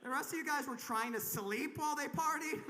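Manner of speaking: usual speaking voice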